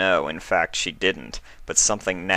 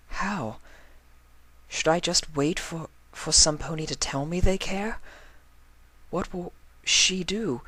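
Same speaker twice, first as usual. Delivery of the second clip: much higher, soft, halting question; soft teary mare, much higher; teary pleading; 11 semitones higher, 3 dB quieter than usual. Acted. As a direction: ask through held-back tears. Teary Octavia presses Vinyl.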